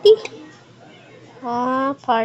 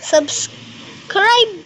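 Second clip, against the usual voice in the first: excited; 8 semitones higher than usual. Real, not acted. Outro call to subscribe.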